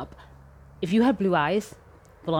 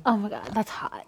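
[name] voice; 4 dB quieter than usual. Pretend. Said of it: "[name] voice".

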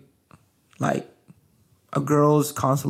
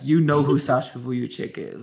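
ordinary delivery

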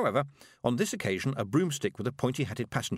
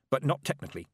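quick; 4 semitones higher, faster than usual. A narrator's reading. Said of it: "and added quickly".

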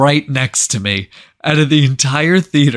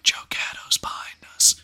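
hushed, secretive whisper; 6 dB quieter than usual. Retold or imitated. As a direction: whisper it to the friend beside you. Secretly nudging friend in cinema.

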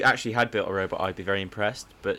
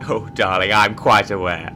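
suave captain; 7 dB louder than usual. Acted. Acted pilot sketch.

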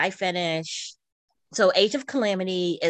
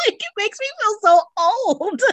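high, loud laughing lament; 6 dB louder than usual. Real, not acted. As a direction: laugh through it, mock despair. Laughing about Tony Hawk's age.

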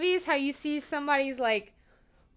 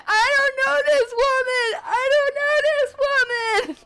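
mock panicked yell; 14 semitones higher, 6 dB louder than usual. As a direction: yell it in pretend panic, pitched way up. Mock plea to security guard.

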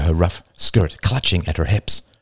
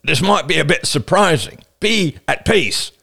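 raised voice, slowing on the last phrase; Roman adding reassurance; 7 semitones higher than usual. A narrator's reading. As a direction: call it out, then stretch the closing plea. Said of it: "Roman added".

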